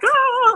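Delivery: high excited gasp; a quick thrilled ah. Retold or imitated. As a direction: squeal of excitement. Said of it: "my voice was, like, amped up".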